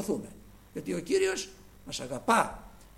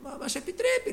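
Eve's reply; 11 semitones higher than usual. Retold or imitated. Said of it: "έπιασε κουβέντα το διάβολο".

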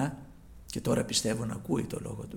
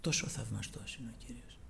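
awed whisper; 2 semitones lower, 9 dB quieter than usual. Real, not acted. Awed aside in sermon.

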